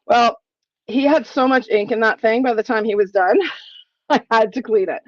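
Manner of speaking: ordinary delivery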